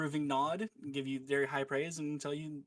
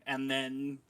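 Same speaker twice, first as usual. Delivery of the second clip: usual speaking voice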